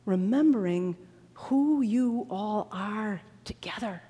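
slow, soft, weighty delivery; hushed, solemn reading. Acted. Preacher slowly reading scripture to the congregation, hushed and solemn. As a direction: let each phrase sink in, almost whispered.

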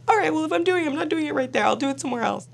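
high, whiny mock-crying, easing down; tearful giving in. Crying wife agreeing to tattoo husband, whining.

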